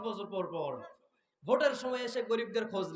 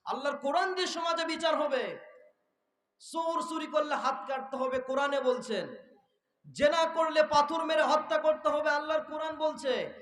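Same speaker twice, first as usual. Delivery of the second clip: high, ringing proclamation; forceful preaching; 7 semitones higher, 5 dB louder than usual. Real, not acted. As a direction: lay down the law to a crowd in a raised voice. Preaches the law. (চড়া গলায় ওয়াজের ঘোষণা)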